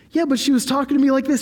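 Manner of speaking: defensive high retort. Retold or imitated. Defending himself to the pastor.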